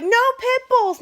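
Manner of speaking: high, loud mock bark of a stubborn old man; sneering mimicry of a rule-quoting boss; mocking the father-in-law. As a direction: parody him, shrill and high. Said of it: "He's like".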